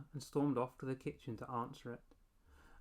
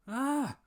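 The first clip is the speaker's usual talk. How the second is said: high loud scream of fright; terrified wail; 12 semitones higher, 10 dB louder than usual. A narrator's reading. Boy screams at monster baby, high shriek. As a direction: shriek in sudden terror. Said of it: "I wailed".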